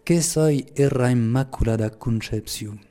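slow Occitan patois of Lourdes; Pyrenean patois. Shown as a demonstration. Teacher quoting Mary's enigmatic phrase, in Pyrenean patois. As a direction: quote it slowly in the local patois accent. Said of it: "je vais le dire en patois".

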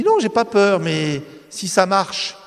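protest, then slow down on si ça marche; young man protesting. Retold or imitated. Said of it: "je lui dis, t'as peur?".

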